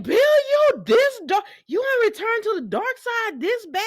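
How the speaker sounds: very high, slow disbelieving question; incredulous. Retold or imitated. Host asking Bill if he has gone dark, high and incredulous. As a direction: stretch it out in total disbelief. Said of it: "I said".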